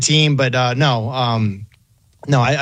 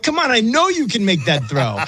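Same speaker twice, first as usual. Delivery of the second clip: mock TV yelling; 7 semitones higher than usual. Fan urging the quarterback through the TV, mock yelling. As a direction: plead with the player through the screen. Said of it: "like, yelling at the T V".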